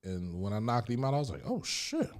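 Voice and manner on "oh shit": surprised reaction. Retold or imitated. Reaction after knockout.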